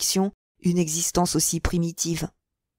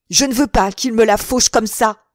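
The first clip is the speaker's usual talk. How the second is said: angry outcry; 3 semitones higher, 5 dB louder than usual. Acted. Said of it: "s'écria l'autre".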